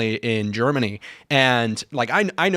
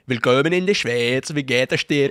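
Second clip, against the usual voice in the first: singsong Swiss German rendition; imitated Swiss German dialect; Swiss German accent. Shown as a demonstration. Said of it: "the Swiss German is like".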